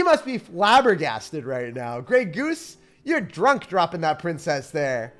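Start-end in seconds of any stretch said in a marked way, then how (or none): none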